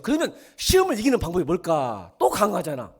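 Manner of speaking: 높고 큰 소리로 묻고 답하기; 강조하며 청중을 다그치듯. Real, not acted. Preacher exhorting congregation. (목소리 높인 설교)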